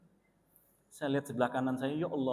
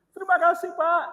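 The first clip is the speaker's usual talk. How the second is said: grateful mother; 13 semitones higher, 7 dB louder than usual. Retold or imitated. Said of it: "kata ibu ini".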